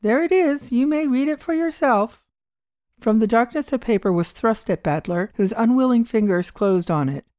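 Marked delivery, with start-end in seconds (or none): 0.0-2.2 lightly voiced old man. Old man hands over paper.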